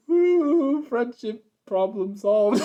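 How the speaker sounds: very high, drawn-out "ooh" sliding into slow words; hammed-up cartoon wail through giggles; mock wailed cheer. Reviewer imitating Starlight after Pinky runs away, hammed-up mock cheer. Mimics Starlight while laughing.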